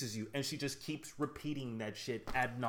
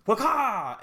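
loud, high mock "Waka!" burst; shouted waka effect; 9 semitones higher, 11 dB louder than usual. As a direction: bark out "Waka!" like a game sound effect. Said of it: "And then she goes".

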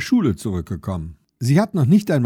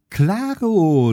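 put-on high, louder boyish laugh-in-the-voice; laughing little boy; 7 semitones higher, 5 dB louder than usual. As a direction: chuckle it out like a cocky small boy. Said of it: "lacht Leon".

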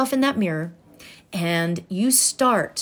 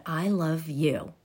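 soft retold affirmation; 4 semitones lower, 6 dB quieter, slower than usual. Retold first mirror affirmation.

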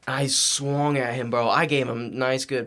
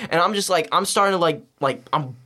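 ordinary delivery

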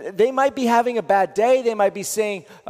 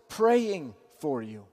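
slow, soft and lower; tender prayerful words; 5 semitones lower, 5 dB quieter, slower than usual. Shown as a demonstration. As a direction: murmur it gently like a promise. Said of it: "Three other words".

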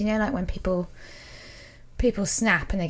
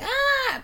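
imitated scream. Guest imitating Fay Wray tied to posts, mock scream. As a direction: squeal like a film damsel in distress. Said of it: "she's, like, screaming".

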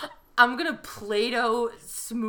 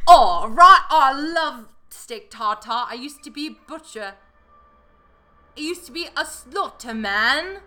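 mocking British accent, opening loud and stretched; 5 semitones higher, 7 dB louder than usual. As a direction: do an over-the-top British butcher. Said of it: "so fun to do this accent".

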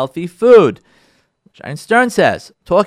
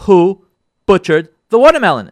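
loud, indignant acted accusation; upset wife; 3 semitones higher, 7 dB louder than usual. Wife at the fridge to husband, indignant. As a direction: demand the culprit, hitting each word hard. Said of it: "she's getting so upset".

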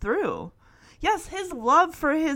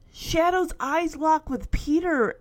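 usual speaking voice

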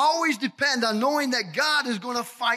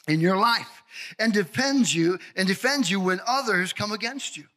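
ordinary talk, nothing marked

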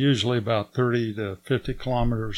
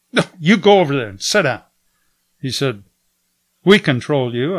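guard's order; 2 semitones higher, 8 dB louder than usual. Retold or imitated. Guard ordering them to sit.

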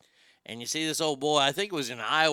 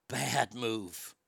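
ominous hush; 5 semitones lower, 8 dB quieter than usual. Acted. Comic warning repeated.

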